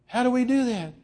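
high, small pleading voice; mock tearful plea. Preacher in tears asking how, lightly acted plea. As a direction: beg for an answer, lightly tearful. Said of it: "He's just in tears by now".

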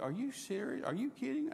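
can't believe his luck; stunned waiter asking if it's real; amazed disbelief. Retold or imitated. Waiter disbelieving the generous tip.

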